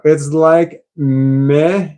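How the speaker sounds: It's like meh stretched out slowly; slow, deflated meh; slow drawn meh. Shown as a demonstration. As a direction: drag out the meh, unimpressed. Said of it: "Oh, here we go".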